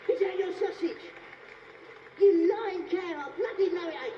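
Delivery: high, loud comic scolding; mock outrage. Magician berating his volunteer over the trick, mock-furious shout. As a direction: shout the accusation, dragging the insult out.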